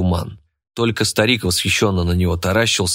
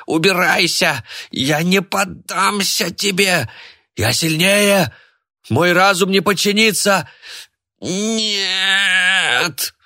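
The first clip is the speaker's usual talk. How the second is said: desperate shouting; 10 semitones higher, much slower than usual. A narrator's reading. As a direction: fight off the voice in your head. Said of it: "закричал старик".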